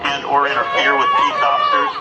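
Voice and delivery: booming police loudspeaker; blasting sound cannon. Real, not acted. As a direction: dispersal order over loudspeaker. Police loudspeaker order to disperse.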